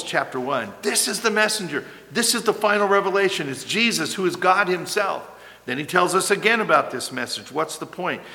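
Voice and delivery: ordinary talk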